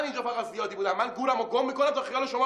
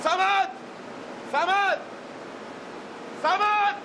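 calling out; 8 semitones higher, much slower than usual. Acted. Calling Samad from afar.

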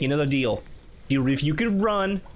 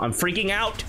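rising panic; 3 semitones higher than usual. Real, not acted. Player fearing a chase, panic rising audibly. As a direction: let the panic rise on the last word. Panic breaks out on last repeat.

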